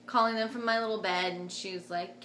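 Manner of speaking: usual speaking voice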